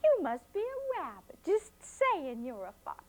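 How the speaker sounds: put-on high, cheeky child; cheeky girl teasing the fox; teasing little girl. A narrator's reading. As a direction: needle him, voice up high. Flossie teases fox.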